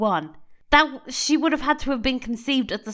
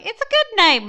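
mock Twitter user; 12 semitones higher, 6 dB louder than usual. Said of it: "they were like".

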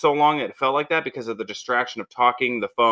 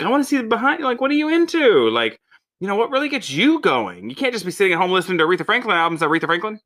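high, fast eager prodding; animated; 9 semitones higher, 3 dB louder than usual. Retold or imitated. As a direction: push her, eager and quick. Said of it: "to say, like".

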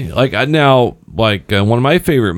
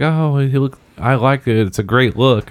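higher-pitched mock compliment; imitating wife's friends; 6 semitones higher than usual. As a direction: lightly gush like an admiring friend. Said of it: "my wife's friends were commenting".

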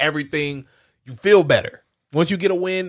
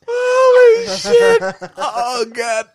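real shocked yelp, very high and loud, drawn out; jolted by a sudden pain; startled cry; 14 semitones higher, 7 dB louder, much slower than usual. Real, not acted. Host jumps at a sound effect, crying out.

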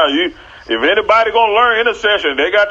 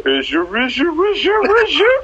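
climbing much higher with each repeat; teasing rising question; 8 semitones higher than usual. Acted. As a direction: tease them, pitch going way up at the end.

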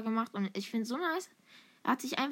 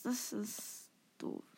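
ordinary talk

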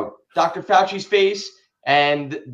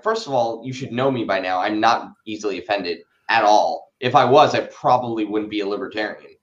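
dismissive retort; 8 semitones lower than usual. Retold or imitated. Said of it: "I'm like".

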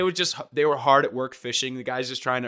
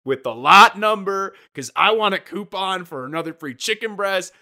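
higher, louder entitled whine; a self-satisfied customer demanding his due; petty complainer; 8 semitones higher, 9 dB louder than usual. Retold or imitated. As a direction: play the smug coupon hunter. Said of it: "And then you're like".

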